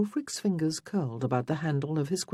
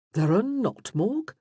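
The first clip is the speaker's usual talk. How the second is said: higher, mock-shocked question; shocked surprise; 7 semitones higher, 4 dB louder than usual. A narrator's reading. Elaine teases Morgan about the dungeons, shocked surprise. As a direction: feign astonishment with a lifted pitch. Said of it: "all shocked surprise".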